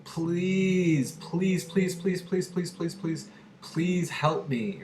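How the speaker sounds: high, begging please, please, please; pleading. Shown as a demonstration. Teacher showing students how to beg, high and pleading. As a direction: beg like you really need help. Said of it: "Beg is when I'm saying".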